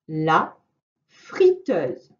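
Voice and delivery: each word set apart for dictation; careful dictation voice; slow dictation reading. Shown as a demonstration. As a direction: read it out word by word for a child to write. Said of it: "Premier groupe de mots".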